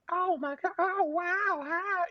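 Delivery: very high, excited little animal squeal; squeaky cartoon critter. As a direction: gush like a tiny cartoon critter. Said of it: "it's the South Park, you know, killer Christmas animals".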